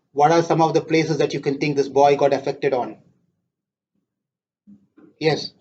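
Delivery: ordinary delivery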